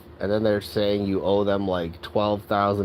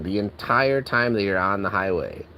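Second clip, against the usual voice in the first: ordinary talk, nothing marked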